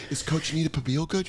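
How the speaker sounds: soft whispered question. Retold or imitated. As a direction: whisper it guiltily. Whispered food talk at sad party.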